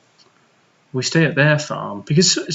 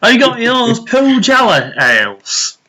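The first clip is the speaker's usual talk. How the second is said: mock Yorkshire accent; 9 semitones higher, 5 dB louder than usual. Asking after his Pujala ales, mock Yorkshire accent. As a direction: put on a broad Yorkshire voice. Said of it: "You keep saying Yorkshire accent".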